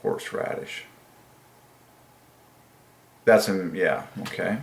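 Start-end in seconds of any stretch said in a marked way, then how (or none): 0.0-0.8 hushed whisper of horseradish; whispered word. Real, not acted. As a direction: whisper it softly.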